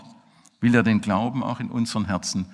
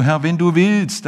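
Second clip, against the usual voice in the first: raised, higher plea; crying out to him; 7 semitones higher, 8 dB louder than usual. Acted. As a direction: call it out across the distance. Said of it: "spricht und schreit ihm zu".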